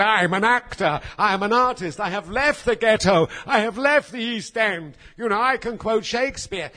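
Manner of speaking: a hint of a grand actor's protest; indignant protest. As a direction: proclaim you're an artist, voice up. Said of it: "I said".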